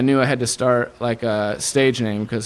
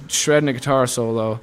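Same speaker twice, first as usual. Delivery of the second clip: ordinary talk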